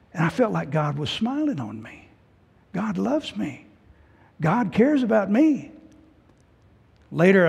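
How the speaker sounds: ordinary talk, nothing marked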